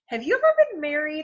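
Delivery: put-on high, fast and loud girl's question; Allison's jangly voice. A narrator's reading. Allison asks the new teacher, high and quick. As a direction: plink it out like a cat on piano keys. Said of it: "voice was like a cat walking across the piano".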